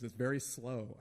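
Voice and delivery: ordinary talk, nothing marked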